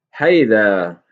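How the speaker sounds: loud, stern shout at the cows. A narrator's reading. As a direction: bellow to drive the cows off. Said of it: "he shouted".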